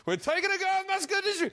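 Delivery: very high, quick put-on voice; hammed-up plot line. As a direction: pitch the absurd plot in a high squeal. Acting out far-fetched film plot.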